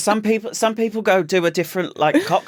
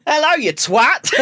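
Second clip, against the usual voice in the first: put-on slow, higher greeting in an Australian accent, laughing; drawn-out Australian hello, giggling; slight Australian twang; 7 semitones higher, 4 dB louder than usual. Reading his greetings to neighbours, laughing Australian twang. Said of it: "saying it with a slight Australian twang".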